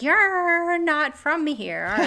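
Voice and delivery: lightly voiced curious local, drawn out; mock local surprise. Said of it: "they're like".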